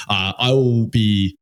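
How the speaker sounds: usual speaking voice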